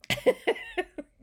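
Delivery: high squealing laugh. Real, not acted. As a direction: laugh till you squeak. Laughing to tears.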